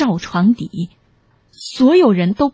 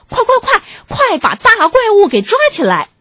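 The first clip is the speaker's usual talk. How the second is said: very high, frantic urging; excited, high-pitched command to charge; urgent rallying cry; 11 semitones higher, faster than usual. A narrator's reading. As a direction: rush everyone into the attack. Characters charge in to catch monster.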